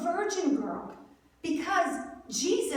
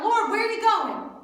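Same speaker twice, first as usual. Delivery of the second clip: urgent, louder, higher-pitched question; blurted out; 5 semitones higher, 4 dB louder than usual. Retold or imitated. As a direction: cut in loudly, acting out an eager disciple. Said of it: "He blurts out".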